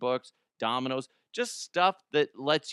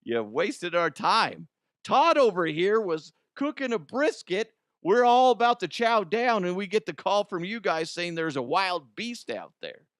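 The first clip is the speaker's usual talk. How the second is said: mock angry cop; 7 semitones higher, 5 dB louder than usual. Deputy scolding the teens for the wasted Bigfoot call, mock angry. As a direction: rant as a fed-up sheriff's deputy. Playing an annoyed officer.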